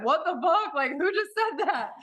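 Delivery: high, laughing astonishment; amazed at her own words; laughing disbelief. Retold or imitated. Echoing husband in amazement, high laughing. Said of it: "And I said".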